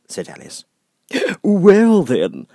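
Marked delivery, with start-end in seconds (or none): 1.4-2.5 grinning Cheshire Cat. Acted. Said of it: "the cat went on".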